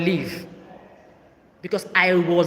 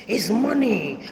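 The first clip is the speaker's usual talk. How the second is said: drawn-out mock; 3 semitones higher, much slower than usual. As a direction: stretch it out, mocking her mother. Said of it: "You see how I'm doing?".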